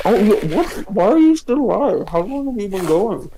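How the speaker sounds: talking through a toothbrush; while brushing teeth. Real, not acted. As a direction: mouth full of toothpaste. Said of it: "That's why I sound like that".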